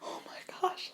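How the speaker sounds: breathy whispered gasp of disbelief; a hushed, voiceless oh my gosh; retold whisper. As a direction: whisper it, stunned. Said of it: "I'm like".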